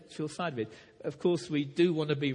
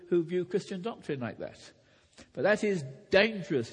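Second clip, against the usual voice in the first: normal talking voice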